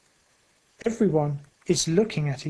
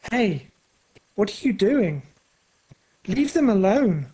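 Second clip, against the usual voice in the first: Patrick's protest; 3 semitones higher than usual. A narrator's reading. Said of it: "says Patrick".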